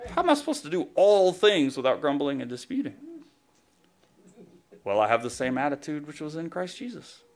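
usual speaking voice

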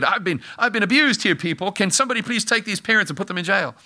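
high, whiny mock protest; mock indignant kid. Jesus imagining a bratty protest to his parents, mock outrage. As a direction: ham up a wronged child. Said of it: "have a big yelling match".

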